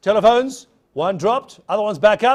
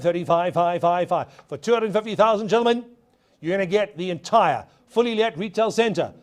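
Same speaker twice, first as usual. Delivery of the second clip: ordinary delivery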